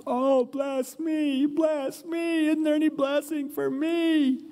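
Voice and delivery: high wailing cry, slowed. Acted. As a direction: wail it out like a desperate son begging. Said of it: "Esau starts screaming out, crying".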